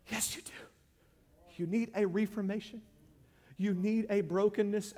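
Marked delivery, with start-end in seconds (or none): none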